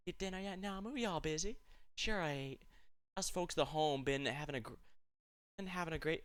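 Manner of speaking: a homey Southern mom checking on her daughter; Southern country accent. Acted. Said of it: "oh wow, I chose the right accent for this".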